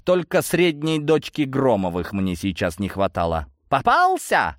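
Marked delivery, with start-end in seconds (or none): 3.7-4.6 high, pouncing cry of triumph; gleeful exclamation. A narrator's reading. Blonde catches Osokin fleeing from her, gleeful exclamation. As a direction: crow it high, delighted at the catch. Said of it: "обрадованно воскликнула блондинка".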